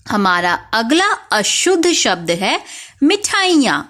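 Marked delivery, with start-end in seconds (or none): 3.0-3.8 teacher announcing word. Shown as a demonstration. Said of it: "अक्सर हम मिठाइयाँ में बड़ी ई".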